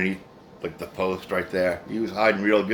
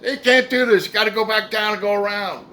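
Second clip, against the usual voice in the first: cop voice; 12 semitones higher than usual. Retold or imitated. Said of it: "Police come".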